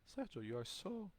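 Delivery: softly imitated admiring woman; woman searching for words. Woman admiring him at lunchtime, softly groping for words. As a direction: hesitate softly before the final word. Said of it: "she's looking for the word to say".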